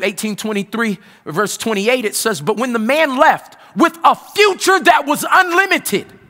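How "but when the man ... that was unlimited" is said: loud, climbing preacher's cry; preaching at full force. Acted. Pastor testifying to his congregation, preaching at full force. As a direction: preach it out, building to a roar.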